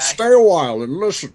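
lightly voiced old storyteller; Deckard Cain voice. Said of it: "Do the voice".

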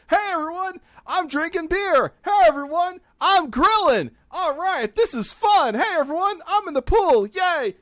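very high, loud, giddy party-bro voice; drunk fourth of July guy. Retold or imitated. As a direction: squeal it like a hyped drunk neighbor showing off. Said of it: "It's like".

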